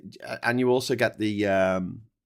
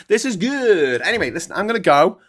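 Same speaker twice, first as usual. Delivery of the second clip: 1 loud cheery sign-off; 9 semitones higher, 11 dB louder than usual. Real, not acted.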